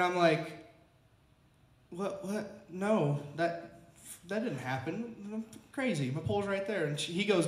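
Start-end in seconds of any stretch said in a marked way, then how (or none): 1.9-6.9 soft, halting, stunned denial; frozen disbelief. Retold or imitated. Angler talking to himself after losing pole, stunned. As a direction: say it haltingly to yourself, refusing to believe it. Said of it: "I'm just kind of frozen".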